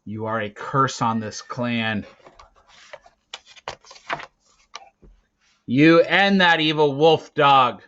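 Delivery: loud, building to a furious roar; furious leader condemning the boy; raging accusation. Acted. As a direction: hurl the curse at him.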